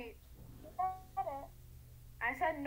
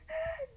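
sudden gasp; 13 semitones higher than usual. Real, not acted. Realizes the answer.

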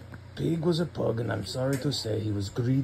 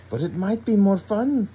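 high, louder sweet little dog voice; Trevor voice; 9 semitones higher, 5 dB louder than usual. Acted. Dad reading Trevor to his daughter, high and sweet. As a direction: play the kind little wiener dog, high. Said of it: "Trevor said to Pig".

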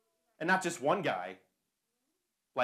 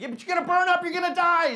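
high, loud alarmed shout; urgent warning; 14 dB louder than usual. Retold or imitated. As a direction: yell to make them see the danger. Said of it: "It's like".

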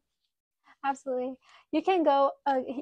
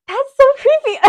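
high, loud real squeal of alarm; creeped-out outburst; 11 semitones higher, 11 dB louder than usual. Real, not acted. Streamer reacts to a creepy chat message, creeped-out outburst. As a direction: burst out high and loud, half laughing.

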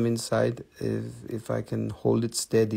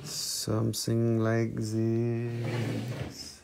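slow "Something like this." with every word stretched; drawn out slowly; 6 dB quieter, much slower than usual. Shown as a demonstration. Seller swinging a pendulum for viewers, slow drawn-out words.

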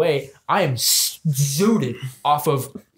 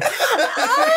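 dragon moan. Pretend. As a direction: let out a sudden high yelp like a dying dragon. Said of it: "And they're going".

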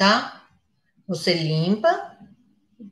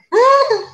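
playful hum; 6 dB louder than usual. Real, not acted. Teasing about the pencil's strength.

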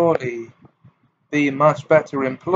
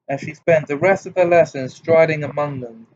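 usual speaking voice

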